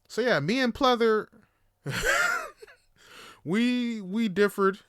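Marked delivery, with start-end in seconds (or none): 1.8-2.6 short real high-pitched laugh. Real, not acted. Laughing after naming his date Pleather, high giggle.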